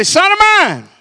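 loud, high call across a yard; father's summoning call. Retold or imitated. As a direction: shout it to the boys down the street. Father calling sons outside.

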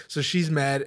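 usual speaking voice

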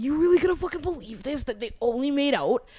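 heated mock outrage; retold indignation. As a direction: push it out in disbelief. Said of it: "I was like".

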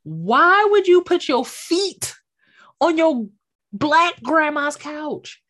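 indignant rhetorical question; exasperated. Real, not acted. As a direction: drag out the outrage. Incredulous rant.

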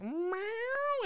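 high, drawn-out meow; the cat greets him with a long, high meow. Retold or imitated. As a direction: mew like the cat. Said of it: "it just looks at me and goes".

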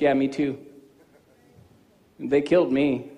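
Jesus quietly telling the believer he was killed too; gentle Jesus voice. Acted. Voicing Jesus in dialogue.

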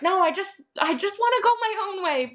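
exasperated, insisting on your own way; frustrated. Retold or imitated. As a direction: push back in frustration, pitched well up. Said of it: "I'm frustrated because I'm like".

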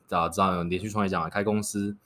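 usual speaking voice

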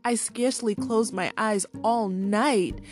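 cartoon princess. Princess voiced in story.

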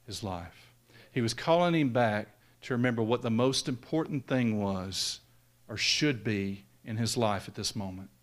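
usual speaking voice

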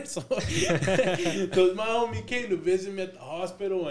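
high, loud, talking through laughter; real laughter breaking into the words; laughing. Real, not acted. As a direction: crack up while telling the story. Laughs at own crying story.

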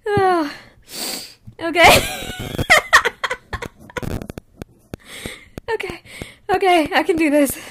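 high, loud, giggle-broken attempt to recover; fighting real laughter. Real, not acted. Podcaster psyching herself up to retake the line, fighting laughter. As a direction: try to compose yourself and crack up. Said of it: "get through this without laughing".

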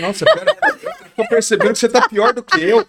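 high, loud, laughing parody of a fussy helper; mocking overdone helper. Joking about overreacting helpers, loud laughing parody. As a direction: ham up a flustered consoler through laughter. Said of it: "Vai chegar outra pessoa e falar assim".

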